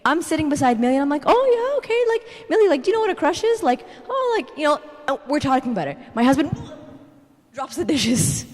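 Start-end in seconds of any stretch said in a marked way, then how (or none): 1.3-3.6 high-pitched, breezy parent voice; feigned casual mother. Retold or imitated. Mother feigns calm with daughter. 4.1-4.8 high, breezy "no big deal" voice; feigned casual mother. Retold or imitated. Mother feigns calm with daughter.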